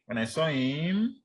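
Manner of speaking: "him" stretched and swept upward; rising, unfinished clause. Shown as a demonstration. Teacher showing students it goes up, rising intonation. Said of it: "so it goes up".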